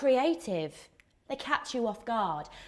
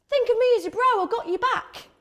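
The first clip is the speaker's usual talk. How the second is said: much higher, chummy bro; mock bro voice; 11 semitones higher, 5 dB louder, faster than usual. Friend-zoned guy offering to be her bro, high and chummy. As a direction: play the eager buddy. Mocking the brother type.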